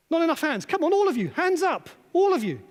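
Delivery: loud, high coaxing call to the audience; urging the crowd. Real, not acted. Speaker urging audience to raise hands.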